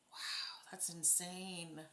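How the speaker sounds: slow, breathless whisper of disbelief; hushed and slow; awed whisper. Real, not acted. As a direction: whisper it, too stunned to speak up. Stunned by a spirit box message.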